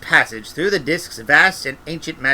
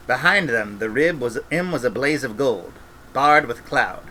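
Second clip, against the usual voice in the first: ordinary delivery, nothing marked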